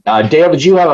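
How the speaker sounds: usual speaking voice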